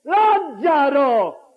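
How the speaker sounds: high, long-drawn call; great cry. Acted. Jesus summoning the man four days dead, great cry. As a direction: cry the name out slowly, full voice. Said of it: "gridò a gran voce".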